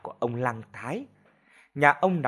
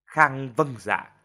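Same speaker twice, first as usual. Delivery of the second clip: ordinary delivery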